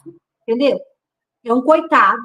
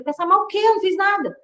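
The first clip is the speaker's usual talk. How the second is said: higher-pitched indignant comeback, retold; retrucando indignada; 8 semitones higher than usual. Retruca acusação da amiga. (indignada, em tom mais agudo)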